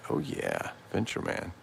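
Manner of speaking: usual speaking voice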